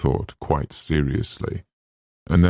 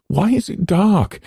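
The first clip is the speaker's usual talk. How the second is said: high, louder fearful asking; frightened question; 5 dB louder than usual. Acted. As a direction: plead to know why it's dark. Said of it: "she tried to ask".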